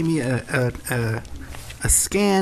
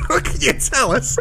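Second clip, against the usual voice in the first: laughing question; 7 semitones higher than usual. Real, not acted. Interviewer to guest about an absurd faith healing, laughing. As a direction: ask it while cracking up.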